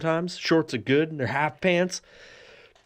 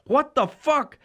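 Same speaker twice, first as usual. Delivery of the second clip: high, startled dog voice; the dog reacting, pitched way up; dog's shocked voice; 10 semitones higher, slower than usual. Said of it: "saw my dick and was like".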